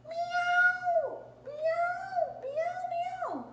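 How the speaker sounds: very high, drawn-out cat meows. Acted. Woman meowing back to the crying cat, high meows. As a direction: meow like a kitten. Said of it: "until I said".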